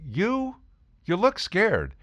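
mock concern. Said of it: "I look at him and I say".

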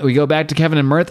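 ordinary talk

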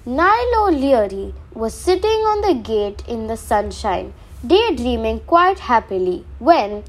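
plain reading voice

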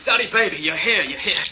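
high, eager cry of recognition; excited relief. Acted. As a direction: call out to her, overjoyed and urgent. Jailed man sees his girlfriend arrive.

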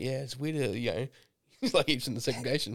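quiet, matter-of-fact mock gossip; dropping a fake rumour, soft and deadpan; deadpan serious. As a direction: say the slander dead straight. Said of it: "super serious shit is hilarious".

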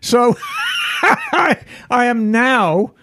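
gleeful laugh breaking through; laughing excitedly. Real, not acted. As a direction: laugh with delight. Gleeful about winning bike.

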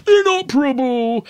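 high-pitched mock shout; quoted shout, pitched well up; imitated shout. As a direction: cry it out, imitating the film character. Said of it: "he then shouts".

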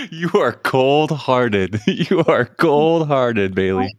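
very slow, drawn-out tease with laughs; amused teasing. Real, not acted. Host teasing the competitive guest, laughing. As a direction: draw each word out through amusement.

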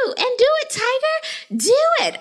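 high mock cheer. Host egging the guest on, high and teasing. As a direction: egg her on in a high voice.

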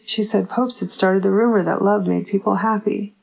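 ordinary delivery, nothing marked

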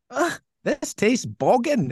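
put-on high, drawn-out disgusted Scottish girl; Scottish accent. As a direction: imitate a grossed-out girl in a broad Scottish accent. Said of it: "in a totally Scottish accent".

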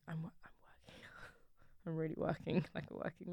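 whispered, laughing a little; whispering. Acted. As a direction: whisper it like a secret. Whispering joke about working.

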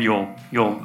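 plain reading voice

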